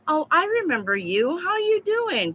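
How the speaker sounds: mock-sugary hello, rising high on how you doing; retold fake-friendly greeting; fake sweet greeting. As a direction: play the boss being sickly nice. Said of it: "Just as nice as can be".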